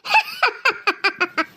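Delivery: staccato villainous laugh; evil ghost cackle. Acted. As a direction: cackle in measured bursts. Ghost laughs after blood threat.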